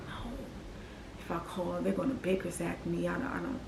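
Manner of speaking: a soft, hushed self-argument; hushed inner voice. Retold or imitated. Arguing with herself about calling the hotline, hushed. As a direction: say it under your breath, afraid.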